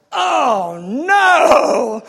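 loud, high, drawn-out protest; hammed-up dismay. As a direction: wail it in mock horror. Acted dismay at God's challenge.

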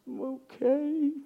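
put-on high, soft childlike whine; little daughter's reply. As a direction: answer in a small, pitiful kid voice. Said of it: "She goes".

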